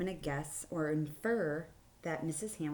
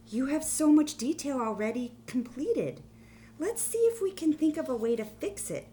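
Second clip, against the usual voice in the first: higher, louder encouraging teacher voice; emphatic teacher; 8 semitones higher, 6 dB louder than usual. A narrator's reading. Art teacher coaxes new student, high and emphatic. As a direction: press the point kindly but firmly. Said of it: "I emphasized".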